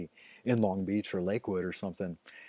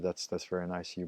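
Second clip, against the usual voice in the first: normal talking voice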